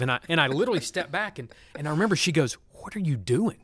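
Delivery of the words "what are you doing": wife's affectionate exasperated question; mock-scold like a baffled spouse; loving wifely scolding. Wife questioning husband who steps back from hug, lightly scolding. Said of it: "in that loving, wifely".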